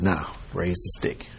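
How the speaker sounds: low, soft order; quiet command. Retold or imitated. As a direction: give the signal quietly and calmly. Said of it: "Then he said".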